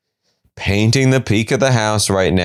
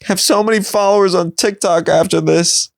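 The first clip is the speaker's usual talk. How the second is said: mock gushing viewer; 10 semitones higher than usual. Viewer teases Jared about fame, high mock-sweet voice. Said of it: "I was like".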